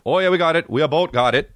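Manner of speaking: put-on jokey Vancouver-accented crew member. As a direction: put on a Canadian accent for the sound guy. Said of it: "'cause he's from Vancouver".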